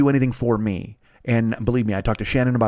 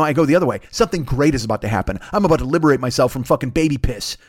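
ordinary delivery

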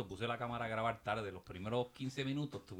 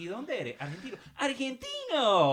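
high, rising imitation of an eager greeter; exaggerated mock enthusiasm; 11 semitones higher, 8 dB louder, slower than usual. Teasing Keki's chats with Latinos, exaggerated eager imitation. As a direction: stretch the last word long, loud and high. Mocking Keki switching accents with strangers.

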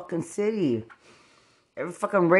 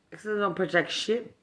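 ordinary talk

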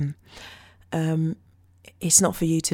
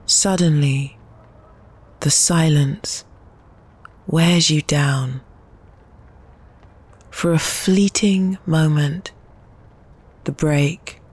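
slow, louder spoken-word reading with long pauses; poem reading; 2 semitones lower, 6 dB louder, slower than usual. Acted. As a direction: drop each word into the silence like a weight. Reads her poem over soundscape.

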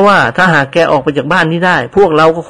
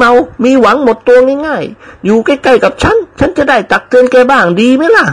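put-on old lady persuading; 10 semitones higher than usual. Old lady urges servant to settle near her, high coaxing voice. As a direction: coax him to live nearby.

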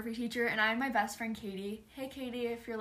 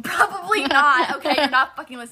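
loud jokey cursing; 3 semitones higher, 11 dB louder than usual. Real, not acted. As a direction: yell it at her, laughing. Cursing out former teacher.

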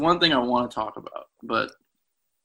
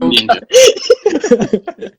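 laughing; 10 semitones higher, 8 dB louder, much slower than usual. Real, not acted. Laughing while saying it.

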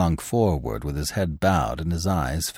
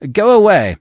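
much higher and louder yell; shouting; 13 semitones higher, 9 dB louder than usual. A narrator's reading. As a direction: bark it through the door. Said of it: "shouted the editor".

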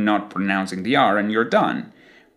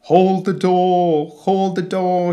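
drawn-out, higher-pitched cry with no R in door; Hodor; 8 semitones higher, 3 dB louder, slower than usual. Retold or imitated. Teacher quoting a Game of Thrones death scene, Hodor. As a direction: call it out slowly, stretching each word. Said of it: "Game of Thrones scene when Hodor dies".

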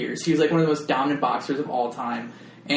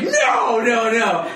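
loud protest; 8 semitones higher, 6 dB louder, much slower than usual. Retold or imitated. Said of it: "And you're like".

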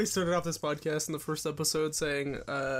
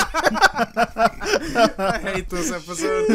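loud, high, slow pleading through laughter; real laughter breaking up a plea; laughing despair; 7 semitones higher, 8 dB louder, much slower than usual. Real, not acted. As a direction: plead helplessly while cracking up. Laughing plea to stop the episode.

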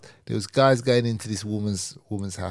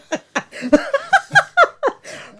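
a loud, high burst of laughter; big laugh; 9 dB louder than usual. Real, not acted. After retelling the joke to her co-host, hearty laughter. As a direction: laugh out loud at your own punchline.

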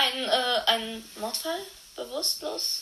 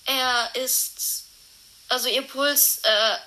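ordinary delivery

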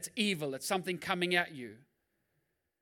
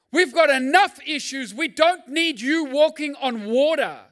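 louder, higher, driving sermon delivery; full-force preaching; 8 semitones higher, 10 dB louder than usual. Acted. Pastor rousing the congregation, loud high preaching. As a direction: preach it hard, building the crowd.

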